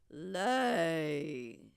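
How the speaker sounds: low booming roar; giant roar. Acted. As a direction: bark the order at the goose like a giant. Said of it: "and roared".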